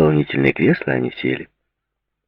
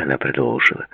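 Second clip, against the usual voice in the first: ordinary delivery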